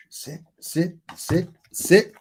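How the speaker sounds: high, rising sit commands to the dog; repeated command. Real, not acted. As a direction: keep calling the dog to sit.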